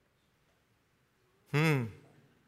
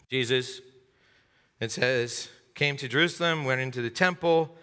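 usual speaking voice

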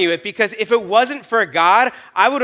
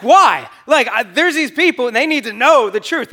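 retold agitated protest; 6 semitones higher, 6 dB louder than usual. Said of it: "And I was like".

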